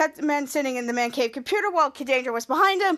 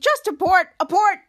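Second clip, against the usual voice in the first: raised, higher urgent command; loud, high, urgent; urgent order; 4 semitones higher, 5 dB louder than usual. Acted. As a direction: call out to cancel it quickly. Character orders computer to cancel.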